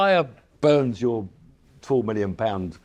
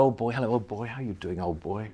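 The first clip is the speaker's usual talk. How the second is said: imitating Sterling; 5 dB quieter than usual. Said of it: "Sterling was like that".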